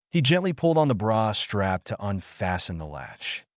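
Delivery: plain reading voice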